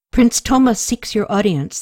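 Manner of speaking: usual speaking voice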